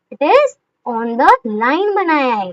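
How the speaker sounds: slow answer. Shown as a demonstration. Teacher stating the answer.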